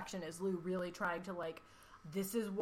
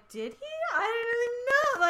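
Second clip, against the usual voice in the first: surprised; 8 dB louder than usual. Retold or imitated. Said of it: "And then Joe's like".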